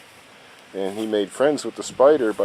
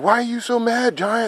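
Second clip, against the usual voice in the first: son's voice; 4 dB louder than usual. Retold or imitated. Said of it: "and he said".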